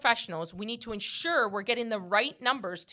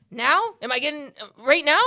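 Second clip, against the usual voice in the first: louder, eager mock-impatient asking; impatient demand; 5 semitones higher, 8 dB louder than usual. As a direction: push him, eager and loud. Impatient self quoted loudly.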